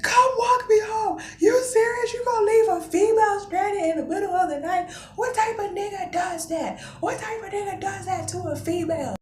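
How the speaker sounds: high-pitched tearful protest; whiny, hammed-up crying plea. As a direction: re-enact wailing indignantly down the phone. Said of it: "I'm crying".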